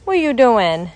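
normal talking voice

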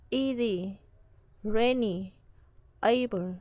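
each word from England to able hit on its first syllable, then falling; word list from England to able, front-stressed, paused between. Shown as a demonstration. Teacher rereads England to able, first-syllable stress. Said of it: "trọng âm sẽ rơi vào âm tiết thứ nhất".

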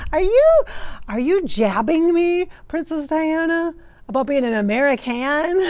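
loud, high, amused challenge; mock indignation. Real, not acted. Mock challenge to a teasing spirit.

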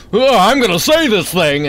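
a hint of a reckless brain talking; tired brain. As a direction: blurt it as a determined, overtired brain. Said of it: "your entire brain just is like".

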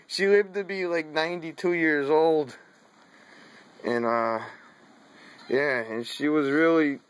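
ordinary talk

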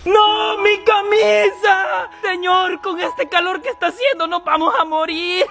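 high, drawn-out comic wailing; hammed-up wail. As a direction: wail melodramatically over a ruined shirt. Llora porque se quema su ropa. (lamento agudo y exagerado)

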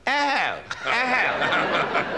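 high imitated hoot of a princess; mock royal hoot. Imitating a princess over her martini, high silly hoot. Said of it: "she was sitting there quietly having her martini going".

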